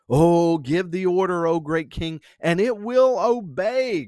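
loud, high fawning entourage; crying out. A narrator's reading. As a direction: urge the king on in hammed-up flattery. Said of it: "cried his entourage".

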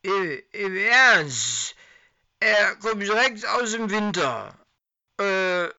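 put-on a drunk man stammering his excuse; drunken stammer. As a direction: stumble over words, tipsy. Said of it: "Das alkoholisierte Stammeln".

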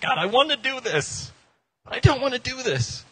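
high, whiny, torn voice; mock whiny dithering. Believer admitting to God he wants and doesn't want to, high mock whine. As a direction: whine both halves like a kid stalling.

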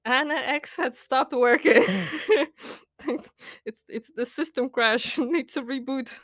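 flustered, amused giggling; laughing through words. Real, not acted. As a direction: giggle through a mock error report. Laughing at own embarrassed system crash.

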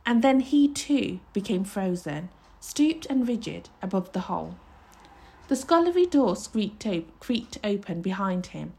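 ordinary delivery, nothing marked

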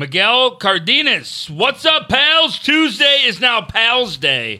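slow, high, drawn-out shout-out; hype announcer read. Acted. Reads super chat in hype voice.

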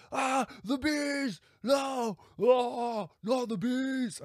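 high, slow, screaming Nicolas Cage. Retold or imitated. Cage tortured with bees, screaming high in terror. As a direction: wail it way up high in panic. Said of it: "I can't do his voice, man. Not when I'm screaming".

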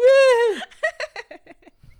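a real burst of laughter shooting high and loud; cackling laugh that nearly breaks into tears; high helpless laugh. Real, not acted. Retelling his dad's crying, he cracks up laughing.

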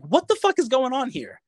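high-pitched retold disbelief; baffled outburst. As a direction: exclaim in bewilderment, pitch shooting up. Baffled as others deny his claim.

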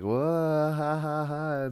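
high, drawn-out put-on laugh; mock group laughter. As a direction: imitate a table's exaggerated laughter. Retold table laughing together.

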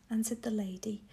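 usual speaking voice

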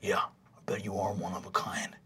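hushed, breathy mocking jab; Rog's snide whisper. Jock whispering an insult at the new kid. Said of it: "Rog says".

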